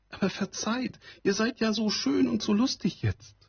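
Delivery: put-on high, wondering young girl; astonished girl. Maren marvels at the rain fairy, high and wondering. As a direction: marvel at her, high and timid.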